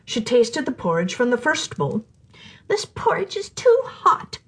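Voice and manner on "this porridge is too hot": put-on much higher, slow girlish exclamation; a small girl complaining the food is too hot; little girl exclaiming. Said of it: "she exclaimed".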